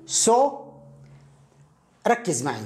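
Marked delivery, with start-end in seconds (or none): 0.1-0.6 سو as a drawn-out rounded "so"; rounded vowel. Shown as a demonstration. Learner's supposed reading voiced by the teacher, rounded-vowel سو. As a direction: hold a round "o" in سو. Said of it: "أليس من المفروض أن نقول".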